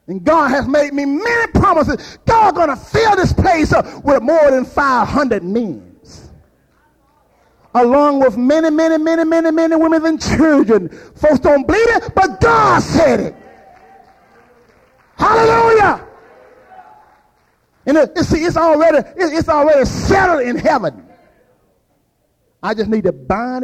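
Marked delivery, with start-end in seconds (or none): 0.1-13.4 high, loud preacher's build-up; full-force preaching. Acted. Preacher rousing the congregation, loud and high. 15.2-16.1 high, loud ecstatic cry; shouted hallelujah. Real, not acted. Preacher crying out in praise, loud and high. 17.9-21.1 high, loud preacher's build-up; full-force preaching. Acted. Preacher rousing the congregation, loud and high.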